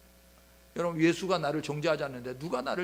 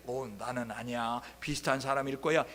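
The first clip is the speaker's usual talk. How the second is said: nervous denial; 5 semitones lower, 3 dB quieter than usual. Retold or imitated. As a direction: brush it off, hoping nobody hears. Peter denies quietly. (낮고 조심스럽게 부인하는 베드로)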